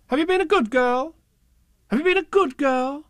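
high, loud sing-song question; mock grown-up sing-song; nagging grown-ups. A narrator's reading. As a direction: nag her in a sing-song.

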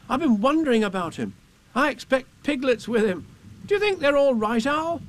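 put-on high-pitched anxious boy; Christopher Robin, worried. A narrator's reading. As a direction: fret about your friends as a little boy. Boy worries about friends.

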